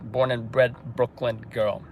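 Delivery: ordinary delivery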